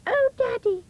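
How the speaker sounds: high, loud little bear wail; crying baby bear. A narrator's reading. Baby bear crying to his father, high wail. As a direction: wail it like a tiny upset cub. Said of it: "cried the baby bear".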